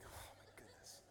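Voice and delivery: low-voiced weary grumble; annoyed dad. Annoyed dad to his daughter, quiet grumble. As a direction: grumble it under your breath. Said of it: "I was just annoyed".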